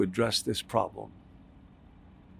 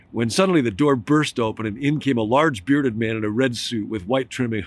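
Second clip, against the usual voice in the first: usual speaking voice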